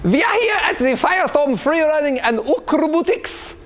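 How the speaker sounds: hammed-up German coach greeting the viewers; over-the-top German accent, bright and bouncy; fake German accent. Parody fitness coach greeting kids and adults, fake German accent.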